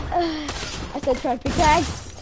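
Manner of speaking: usual speaking voice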